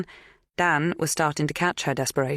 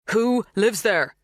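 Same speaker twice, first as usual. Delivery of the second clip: slow, higher, insistent question; said loudly; 6 semitones higher, 4 dB louder, slower than usual. A narrator's reading. Dan pressing a farmhand who didn't reply, loud and slow. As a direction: spell it out slowly and loudly. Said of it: "he said loudly, when Tomasz didn't reply".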